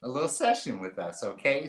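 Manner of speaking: ordinary talk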